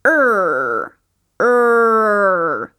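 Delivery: a long retroflex er; English R sound. Shown as a demonstration. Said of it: "o som da letra R é". (som do R inglês)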